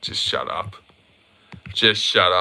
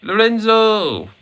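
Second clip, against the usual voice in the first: high drawn-out call of a name; 10 semitones higher, slower than usual. Real, not acted. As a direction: call his name across the room. Streamer calling out to chatter.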